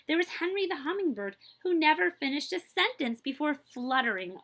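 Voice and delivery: bright, lively storybook reading; animated read-aloud for kids; reading to children. A narrator's reading. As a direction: read it like a picture book to a class.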